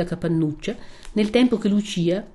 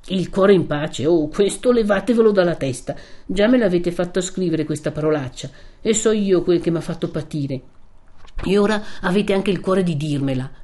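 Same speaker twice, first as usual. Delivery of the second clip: rising, louder impassioned outburst; Renzo, angry protest; 4 semitones higher, 4 dB louder than usual. Acted. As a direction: refuse her, hurt and furious. (Renzo arrabbiato e ferito)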